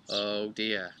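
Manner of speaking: ordinary talk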